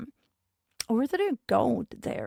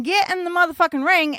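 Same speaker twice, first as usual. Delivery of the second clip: quick, higher imitated yell; mildly raised voice of a fed-up ref; yelling referee; 6 semitones higher, 4 dB louder, faster than usual. Referee still ordering wrestlers into the ring, mock yelling. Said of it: "The ref is still yelling".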